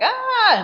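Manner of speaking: high, sudden mock scream of pain; a burned man's yelp, imitated; short high yelp as if burned. Burned man cries out.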